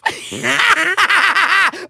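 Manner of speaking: high, rapid staccato evil laugh; villain cackle. Acted. Villain mocking the Skylander, high cackle. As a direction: cackle in mockery, high and pulsing.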